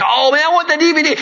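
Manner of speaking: high, quick gadget-lover's gush; excited craving. Retold or imitated. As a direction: gush eagerly, pitch jumping up, as if retelling it. Retells craving each new gadget.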